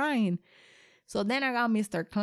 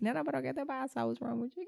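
mother asking, concerned; 5 semitones higher, 4 dB quieter, faster than usual. Retold or imitated. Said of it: "And I was like".

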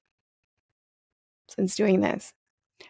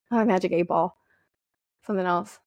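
ordinary talk, nothing marked

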